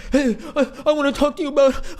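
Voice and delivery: mocking a guy who interrupts a woman's workout, laughing; mock creepy guy. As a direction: mimic a clueless guy cornering someone, laughing. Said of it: "you're like".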